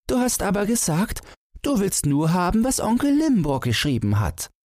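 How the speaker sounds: put-on very high, defiant little boy; defiant child. A narrator's reading. Son argues with father, defiant high child's voice. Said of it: "rief Thomas trotzig".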